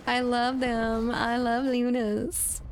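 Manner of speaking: high, slow, gushing coo; dreamy high coo of adoration. Real, not acted. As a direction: swoon over them.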